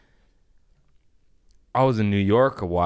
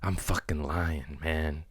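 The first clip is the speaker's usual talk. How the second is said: usual speaking voice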